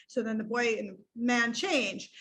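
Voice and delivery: ordinary talk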